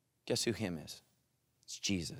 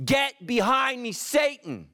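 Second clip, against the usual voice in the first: high, loud, drawn-out command; slow, forceful preacher's shout; thundering rebuke; 11 semitones higher, 5 dB louder, slower than usual. Acted. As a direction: cry it out as a stern rebuke. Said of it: "Jesus ever says these words".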